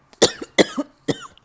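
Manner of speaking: sudden harsh choking cough; coughing fit. Real, not acted. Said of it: "now I'm choking randomly".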